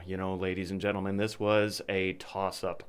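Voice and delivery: ordinary delivery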